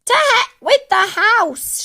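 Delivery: slow, high, screechy yell; defiant shout. A narrator's reading. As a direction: holler it dismissively at full force. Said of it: "she shouted".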